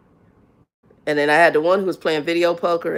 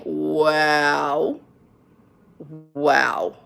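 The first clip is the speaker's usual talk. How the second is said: unimpressed wow; 3 semitones lower than usual. Retold or imitated. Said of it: "the comment was like".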